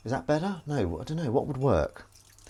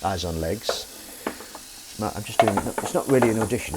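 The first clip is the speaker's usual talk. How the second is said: ordinary talk, nothing marked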